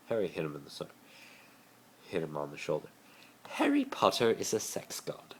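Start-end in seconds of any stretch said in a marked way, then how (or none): 3.5-5.3 higher, proud declaration; Draco's password. A narrator's reading. Draco says his password to Harry, smug. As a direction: announce it with smug pride.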